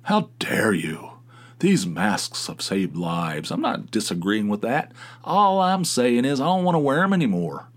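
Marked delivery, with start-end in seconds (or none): 0.0-3.5 slow mock whisper of indignation; mock-indignant people lecturing about masks; mock outraged scold. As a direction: parody a self-righteous scold, slowly. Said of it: "other people, it's like".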